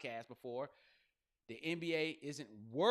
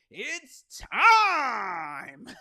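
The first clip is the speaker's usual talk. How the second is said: huge drawn-out ring announcer yell, higher and much louder; fight announcer shout; 8 semitones higher, 15 dB louder than usual. Acted. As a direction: bellow it out to open a UFC bout. Imitates UFC fight announcer.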